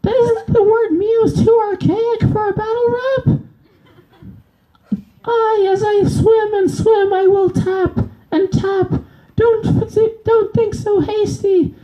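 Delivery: very high, cartoonish squeaky fish; high squeaky fish rapping back. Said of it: "versus a fish".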